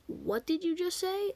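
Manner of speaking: slow question climbing very high; incredulous challenge. Retold or imitated. Student standing up to confront teacher, incredulous high challenge.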